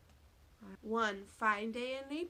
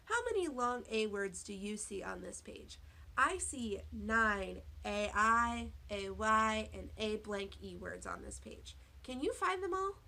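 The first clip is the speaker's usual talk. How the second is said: normal talking voice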